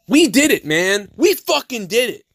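shouted accusation. Real, not acted. Conspiracy rant peaks.

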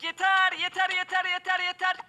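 high, loud pleading, repeated; pleading shout. Pretend. Being hit repeatedly. (yüksek sesle yalvarış)

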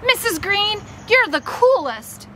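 thrilled and grateful; delighted praise. Acted. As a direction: gush it, drawing out the words. Delighted boy thanks friend's mom.

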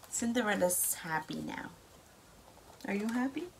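plain reading voice

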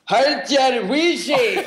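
high, slow, laughing try at a Glaswegian phrase; attempted Glaswegian. Shown as a demonstration. Comedian attempts Glaswegian phrase for hosts, high, slow and laughing. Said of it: "Okay, the first one is".

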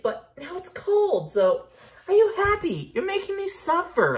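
exaggerated suffering whine; mock outraged whine. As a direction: whine it dramatically. Mock complaint at friend.

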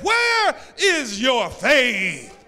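loud, slow, stern shout; rebuking shout. Acted. Jesus rebuking his frightened disciples, loud and slow. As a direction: hit each word like a rebuke.

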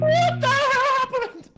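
very high, loud, drawn-out disbelief; shocked shriek. Real, not acted. Player to teammates after sudden chaos, shocked shriek.

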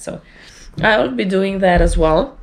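ordinary talk

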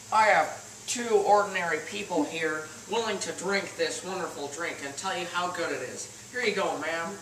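ordinary delivery

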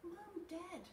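put-on high-pitched child calling Mum and Dad; little kid's urgent call; calling for parents. A narrator's reading.

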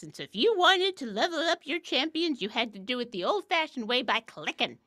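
lightly voiced cranky grandparent; grumpy old-timer. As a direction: grumble like a hint of an old-timer. Grumpy old-timer bit, back in my day.

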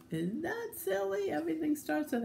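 high-pitched delight at silliness; playful amusement. Real, not acted. Teacher reading to children about the silly cow, high and amused.

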